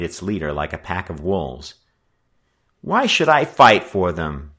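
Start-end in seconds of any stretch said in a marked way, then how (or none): 2.8-4.5 louder, higher protest; insistent, affronted demand; insistent repetition. A narrator's reading. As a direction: press the question indignantly. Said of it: "Joe reiterated".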